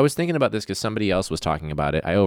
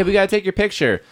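mock clerk; 13 semitones higher, 3 dB louder than usual. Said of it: "Do you have a pic-, hey".

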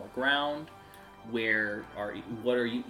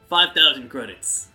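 starport man naming price; 2 semitones higher, 7 dB louder than usual. Acted. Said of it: "And he's like".

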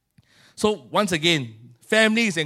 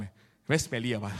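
ordinary delivery

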